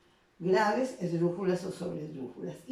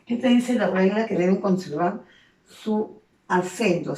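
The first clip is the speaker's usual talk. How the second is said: ordinary talk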